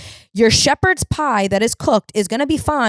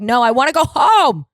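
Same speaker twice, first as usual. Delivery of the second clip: loud, higher, near-shouted complaint; snapping back at the burger lady; exasperated outburst; 6 semitones higher, 5 dB louder than usual. Retold or imitated. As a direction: blow up at the cashier. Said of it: "I'm like".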